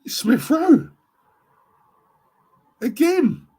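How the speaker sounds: high-pitched, loud excited cry; goal celebration. Real, not acted. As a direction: squeal the scorer's name in delight. Celebrating the scorer live.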